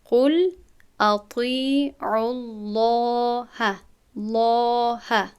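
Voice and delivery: قل اطیعوا الله drawn out with a full, heavy lam; heavy lam in Allah. Shown as a demonstration. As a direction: recite الله slowly with a thick lam after the damma.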